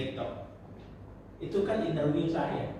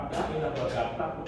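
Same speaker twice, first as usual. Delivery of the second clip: ordinary talk, nothing marked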